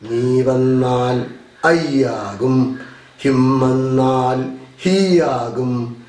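slow, drawn-out classroom recitation; rote drill. Shown as a demonstration. Teacher revising pronoun changes with the class, slow rote drill. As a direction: stretch each pronoun rule evenly. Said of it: "ഒന്നും കൂടി ആവർത്തിക്കുകയാണ്".

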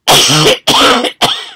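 loud coughing burst. Real, not acted. Interrupted while reading a psalm, coughing. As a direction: cough hard, breaking off the reading. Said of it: "Excuse me".

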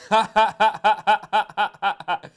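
put-on a loud, high snorting snigger at the accent; loud mocking snigger. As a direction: burst out sniggering. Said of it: "I apologize for sniggering".

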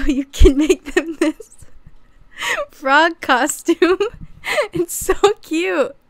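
high, slowed laughter on the words, near tears; losing it laughing. Real, not acted. To viewers about a gecko frog costume, giggling helplessly. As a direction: squeak the words out through giggles. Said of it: "I lost it".

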